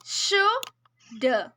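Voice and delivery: शुड, शुड drawn out very slowly, one at a time; counting syllables slowly. Shown as a demonstration. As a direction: stretch each शुड while counting the beat. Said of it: "syllables गिने यहाँ पे". (शुड को बहुत धीरे धीरे खींचकर गिनना)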